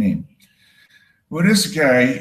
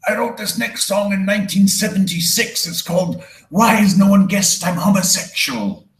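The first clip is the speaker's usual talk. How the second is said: showman's high, ringing rock-frontman announcement; high-pitched, theatrical concert intro; 8 semitones higher than usual. Acted. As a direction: introduce the band to a stadium, high and grand. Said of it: "[name] knew how to introduce a show".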